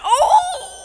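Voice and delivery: loud, very high drawn-out ooh; hammed-up scandalized whoop, held long; exaggerated shock. Viewer reacting to two-faced talk, exaggerated shock. Reacting to contestants being called out.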